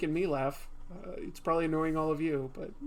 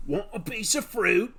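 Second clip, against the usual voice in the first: louder mock Australian growl; lightly voiced Australian Wolverine; Wolverine impression; 4 dB louder than usual.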